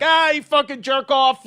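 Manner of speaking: high, loud, slow angry yell; mock furious heckle; yelling insult. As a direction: bark the insult at the crowd. New comic yelling at crowd.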